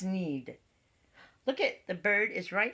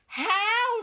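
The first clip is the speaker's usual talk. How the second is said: high, louder question; cow asking; 13 semitones higher, 4 dB louder than usual. A narrator's reading. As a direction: call it out high. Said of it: "said the cow".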